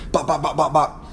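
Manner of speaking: quick bop bop like fists landing; light imitated punches; mock punch sounds. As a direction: pepper the bops like jabs. Punches landing in bar fight.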